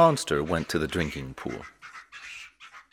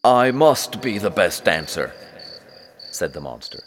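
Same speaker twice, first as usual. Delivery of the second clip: boastful monster; 7 semitones higher, 8 dB louder than usual. A narrator's reading.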